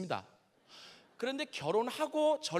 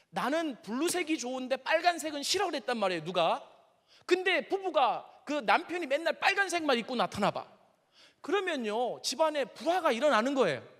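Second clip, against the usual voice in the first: raised, higher preacher's delivery from the pulpit; preaching at full force; 6 semitones higher, 4 dB louder than usual. Acted. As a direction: press the point home like a preacher. Preaching to the congregation. (설교하듯 힘주어)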